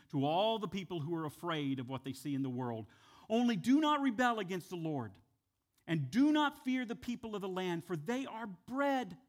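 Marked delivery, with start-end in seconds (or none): 3.3-9.2 scripture read. Acted. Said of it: "here's what they say in Numbers".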